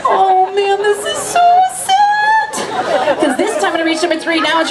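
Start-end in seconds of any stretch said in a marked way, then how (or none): 0.1-3.2 high, slow hammed-up wail; mock sorrow. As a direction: ham up the sadness. Mock sad reaction to crowd.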